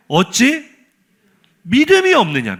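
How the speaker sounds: high, emphatic scolding question; imitated rebuke. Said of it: "두 번째 꾸중이 더 화가 나요".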